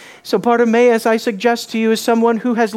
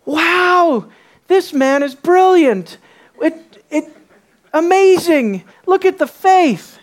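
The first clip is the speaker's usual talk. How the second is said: higher, louder, mock amazement; hammed-up awe, pitched up; amazed crowd; 7 semitones higher, 5 dB louder, slower than usual. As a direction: marvel aloud like an impressed onlooker. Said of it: "the response of the crowd".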